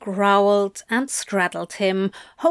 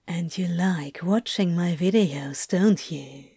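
low unvoiced ASMR whisper; 4 semitones lower, 3 dB quieter than usual. Whispering in his ear, ASMR.

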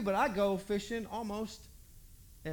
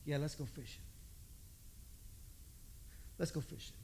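much softer, lower, tired; the disciples agree wearily, much softer and lower; weary agreement; 6 semitones lower, 13 dB quieter than usual. Retold or imitated. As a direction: mumble along half-hearted. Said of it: "everybody goes".

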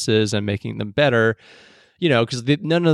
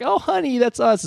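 much higher, sweet mocking wife; 12 semitones higher than usual. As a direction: coo it like a nudging wife. Voicing an audience wife.

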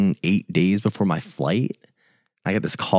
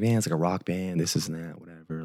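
softer, fading into indifference; going through the motions; 7 dB quieter than usual. Retold or imitated. Talking about shooting a rock band, indifferent. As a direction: retell it half-heartedly, trailing away quieter. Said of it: "just, like, going through the motions".

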